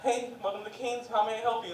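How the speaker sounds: fast food cashier greeting the friend; cheery cashier. Retold or imitated. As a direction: greet like a chipper employee.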